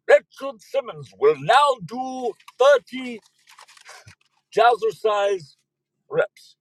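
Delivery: slow, high, loud vampire decree; hammed-up villainous vampire; the Count. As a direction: command it like a gloating cartoon count. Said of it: "The Count has it in for Richard Simmons".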